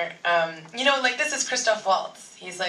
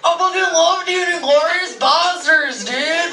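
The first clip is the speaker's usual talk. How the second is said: high, loud bro fan gushing; hyped dude fan, higher and louder; excited fan; 9 semitones higher, 6 dB louder than usual. Retold or imitated. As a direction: play an overeager fan meeting his idol. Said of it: "fans would come up to him and be like".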